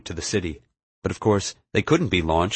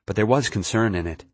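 ordinary delivery

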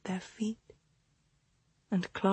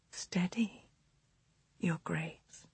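soft breathy whisper, very quiet; 9 dB quieter, slower than usual. A narrator's reading. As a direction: whisper it close and hushed. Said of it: "she whispered".

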